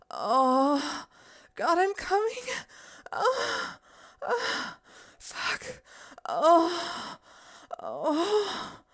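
rising high, loud breathless cries; climax cries. Acted. Mistress losing control in front of her boy, climaxing in high loud cries. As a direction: let it build to a peak, then spill over high and loud.

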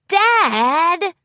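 high, loud exasperated Dad; embarrassed groan. Acted. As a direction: whine it, mortified. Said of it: "Rainbow Dash groaned".

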